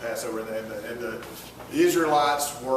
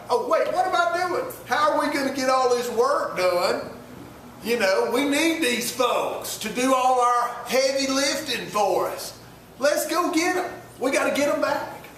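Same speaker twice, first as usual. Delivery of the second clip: higher, sudden realisation; Pharaoh's change of heart; 6 semitones higher than usual. Retold or imitated. Pharaoh realising he lost his workers, alarmed. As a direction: catch yourself mid-mistake.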